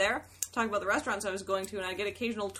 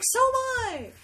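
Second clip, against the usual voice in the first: high, loud imitated delight; excited fan; 13 semitones higher, 4 dB louder than usual. Fan also visiting friends in New York, excited. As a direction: exclaim at the happy coincidence.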